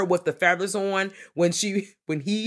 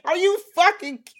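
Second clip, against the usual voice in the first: shocked quote; 13 semitones higher, 4 dB louder than usual. Retold or imitated. Debbie shocked Fester lives.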